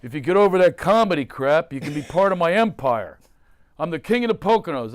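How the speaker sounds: a hint of a brash dad bragging; his dad. Scene: father pushing him to quit comedy, brash. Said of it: "He used to say to me".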